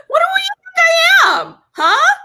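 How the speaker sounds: high, loud, offended outburst; indignant shout. Retold or imitated. Renter to the agent who suggested calling the police, indignant shout. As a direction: shout it way up high, mock-outraged.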